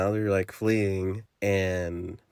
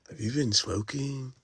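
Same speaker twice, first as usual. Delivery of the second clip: a slightly higher put-on boyfriend voice; lightly voiced boyfriend; 6 semitones higher than usual. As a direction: tease her like a suspicious boyfriend.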